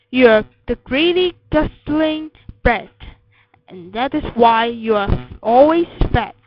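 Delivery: loud, emphatic telling-off; scolding. A narrator's reading. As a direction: lay into the child like a cross mother. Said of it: "my mother cried".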